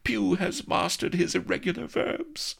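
high, haughty lady keeping him at arm's length; high prim noblewoman setting terms; Vanity's voice. A narrator's reading. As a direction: pitch it high and lofty, mock-archaic.

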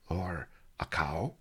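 slow unvoiced whisper; sly whispered aside. Aside after the crow line.

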